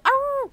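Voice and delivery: high loud growling cry; pirate-like growl. Acted. Dog cheering the player on, loud growl. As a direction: roar in triumph.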